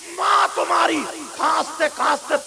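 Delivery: roaring sermon, high and very loud; full-force shouted sermon; fiery preaching. Acted. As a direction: thunder it at the congregation.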